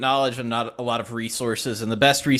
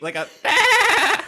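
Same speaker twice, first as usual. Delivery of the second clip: slow, high bleating bah; high sheep bleat; 4 dB louder, slower than usual. Shown as a demonstration. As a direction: stretch it out like a sheep. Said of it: "I need a, a bleat".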